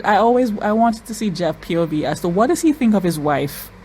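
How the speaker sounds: usual speaking voice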